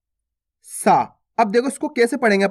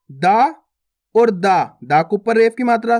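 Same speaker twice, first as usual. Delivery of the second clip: usual speaking voice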